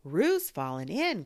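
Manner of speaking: sudden alarmed call; cried out. A narrator's reading. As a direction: cry out in alarm. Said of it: "cried Rabbit".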